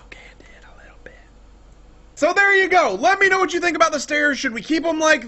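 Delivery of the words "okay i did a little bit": tiny, quick whisper; sheepish confession. Acted. Host caught out by viewer, sheepish whispered confession. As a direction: whisper it as a mock admission of guilt. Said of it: "And I'll be like".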